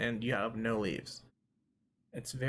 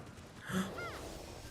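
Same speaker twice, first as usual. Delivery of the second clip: sharp gasp; 9 dB quieter than usual. Real, not acted. Sudden realization, oh no.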